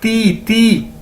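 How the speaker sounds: ती ती with a soft dental t, not टी; soft ti sound. Shown as a demonstration. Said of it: "टी नहीं इसको कहना".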